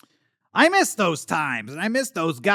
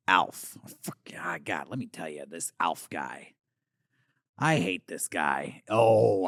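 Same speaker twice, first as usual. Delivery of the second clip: bitter grumble; 10 semitones lower, 5 dB quieter than usual. Acted. Bitter rant about rival Alf.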